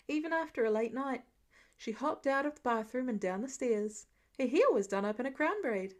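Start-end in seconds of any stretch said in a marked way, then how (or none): none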